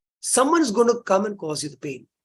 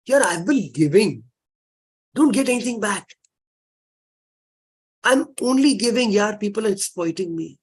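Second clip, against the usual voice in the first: complaining; 3 semitones higher than usual. Retold or imitated. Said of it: "One of our major complaints in life is".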